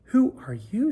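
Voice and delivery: high, loud surprised ask; pony startled by the fairy godmare, high and loud; startled question. Acted. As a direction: jump at the stranger.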